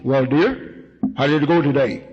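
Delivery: lightly voiced father greeting his wife; a hint of a husband home from work; father coming home. Father coming home to ask about the day, lightly acted. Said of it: "the father can come home and say".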